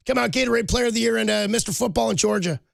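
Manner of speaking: high, sing-song boastful coach; Sean McVay impression. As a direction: brag like a proud coach showing off.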